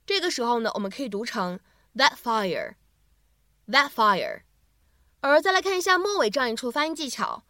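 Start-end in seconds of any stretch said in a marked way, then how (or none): 1.9-4.4 "that fire" with the t held and not released; 不完全爆破 that fire; incomplete plosion. Shown as a demonstration. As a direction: slowly say "that fire", no pop on the t. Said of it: "不完全爆破的处理".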